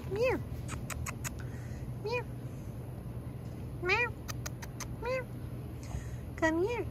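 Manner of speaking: high, soft coaxing coo to shy cats. Real, not acted. Woman coaxing scared gas station cats, soft high coo. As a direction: sweet-talk the stray cats softly.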